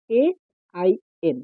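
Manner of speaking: A, I, N as three separate English letter names; spelling out. Shown as a demonstration. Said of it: "আইন মানে কি?".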